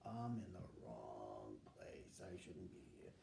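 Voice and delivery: low, slow, quiet muttered aside; muttering to himself. Retold or imitated. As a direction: mutter it under your breath, out of place. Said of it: "I'm going".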